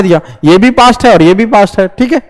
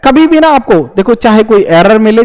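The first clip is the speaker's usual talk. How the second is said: ordinary talk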